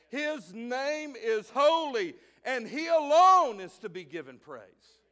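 preacher proclaims God's holiness to the church; full-force preaching. Acted.

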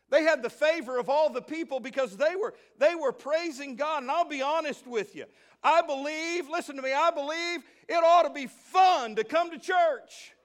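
loud, high preaching call to the church; rally the church, ringing out; preaching at full force. Real, not acted. Preaching with rising intensity.

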